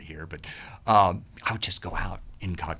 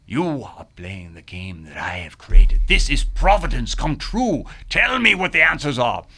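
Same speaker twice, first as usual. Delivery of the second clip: a lightly voiced theatrical bard; Quill the poet; 2 semitones higher, 7 dB louder than usual. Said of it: "I get on my Quill costume".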